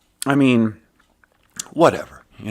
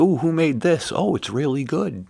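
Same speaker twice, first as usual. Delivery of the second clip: higher-pitched mock delight at the food; 8 semitones higher than usual. Coworkers praising each other's dishes, pleasantly impressed. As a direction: coo over a tasty bite. Acting diners tasting shared food.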